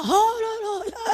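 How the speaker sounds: high, slow mock cry of exasperation; mock wail. Wife exasperated as husband comes home singing, mock wailing. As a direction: wail in comic despair.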